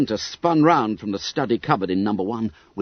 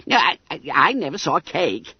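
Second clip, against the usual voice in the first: high, squeaky fat schoolboy; Bunter voice; 7 semitones higher, faster than usual. A narrator's reading.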